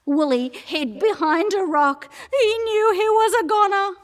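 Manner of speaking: high, loud despairing narration; panicked storytelling. A narrator's reading. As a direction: climb in pitch as all seems lost.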